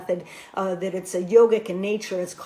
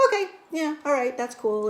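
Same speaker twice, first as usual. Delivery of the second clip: higher-pitched flippant brush-off; 5 semitones higher than usual. Retold or imitated. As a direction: start high, toss it off flippantly. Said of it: "I'm kind of flippant".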